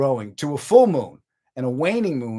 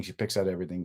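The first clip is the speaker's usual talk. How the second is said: ordinary delivery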